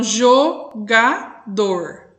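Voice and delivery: "jogador" slowly broken as jo, ga, dor; split into three syllables. Shown as a demonstration. Teacher counts syllables for pupils, "jogador" split in three. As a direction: say "jogador" in three separate slow beats. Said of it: "eu emito o som três vezes".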